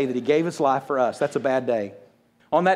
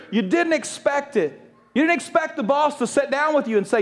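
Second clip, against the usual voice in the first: higher, building sermon cadence; impassioned preaching; 7 semitones higher than usual. Acted. Pastor listing sudden tragedies to the congregation, impassioned preaching. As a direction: preach it with rising intensity.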